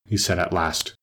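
plain reading voice

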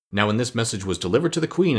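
usual speaking voice

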